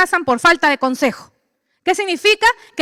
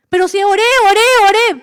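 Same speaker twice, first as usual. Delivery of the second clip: protesting believer; 7 semitones higher, 4 dB louder than usual. Retold or imitated. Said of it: "believer protesting to God".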